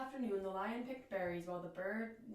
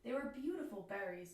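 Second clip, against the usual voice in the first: ordinary delivery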